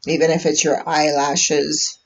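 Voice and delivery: unhurried meditation voice with long pauses; calm, measured guidance, leaving space between phrases; guided meditation. Real, not acted. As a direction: guide listeners gently inward, phrase by phrase. Leads a live meditation.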